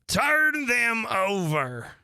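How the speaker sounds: high, slow, loud command; a queen barking out an angry command; angry order. Acted. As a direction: snap the order at the servant. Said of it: "The queen turned angrily away".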